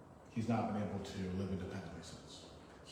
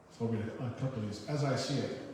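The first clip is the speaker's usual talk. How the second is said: ordinary delivery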